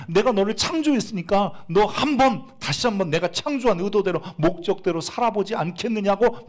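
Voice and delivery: high, loud divine appeal; God's appeal. Retold or imitated. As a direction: voice God's invitation with weight. God's voice quoted in sermon.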